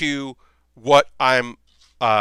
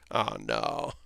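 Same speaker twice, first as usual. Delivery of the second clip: mock dread; 12 dB quieter than usual. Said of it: "And I'm just like".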